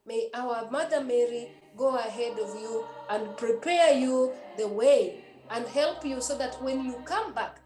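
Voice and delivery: usual speaking voice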